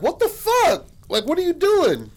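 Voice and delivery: very high, loud imitated disbelief; shocked outburst. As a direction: squawk it in appalled disbelief. Sean's shocked outburst at Zach.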